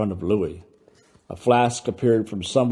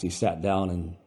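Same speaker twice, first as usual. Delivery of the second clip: plain reading voice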